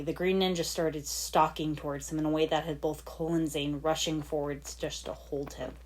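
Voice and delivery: normal talking voice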